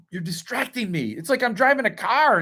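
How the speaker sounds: normal talking voice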